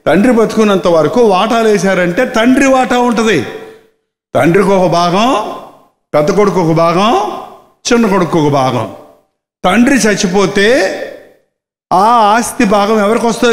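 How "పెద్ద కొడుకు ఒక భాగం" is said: ordinary talk, nothing marked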